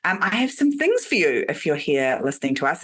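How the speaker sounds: ordinary talk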